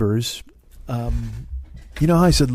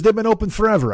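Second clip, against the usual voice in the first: high-pitched imitation of a Swedish neighbor's accent; Oscar's accent; 8 semitones higher, faster than usual. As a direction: put on the foreign accent, pitched well up. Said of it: "he talk like this".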